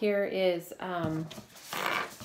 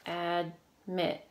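"Admit" slowly split, a clear gap between Ad and mit; syllable by syllable. Shown as a demonstration. Teacher counting syllables for pupils, "Admit" said in two separate parts. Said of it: "How many syllables?".

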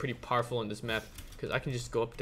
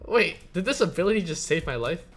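loud, high astonished yelling; can't believe what just happened; stunned disbelief; 7 semitones higher, 8 dB louder than usual. Real, not acted.

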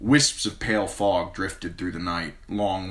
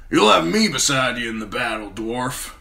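higher, louder rough sellsword; 6 semitones higher, 6 dB louder than usual. A narrator's reading. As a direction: play the cocky sellsword.

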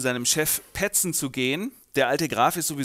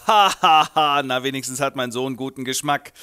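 mock laugh; 7 dB louder than usual. Old count laughs.

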